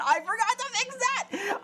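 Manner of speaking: high-pitched dismayed outburst; sudden squeal of realisation; startled exclamation. Real, not acted. Sudden realisation of forgotten fix.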